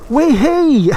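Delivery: high gleeful "way hey"; delighted whoop. Real, not acted. Bike surges, delighted whoop.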